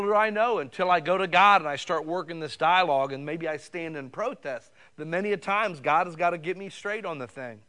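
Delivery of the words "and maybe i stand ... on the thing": ordinary talk, nothing marked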